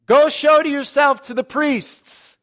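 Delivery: high, loud, slow call; acted long-range yell, words drawn out; yelling from afar. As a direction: shout an order across a distance. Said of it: "Jesus is kinda yelling at them from a distance".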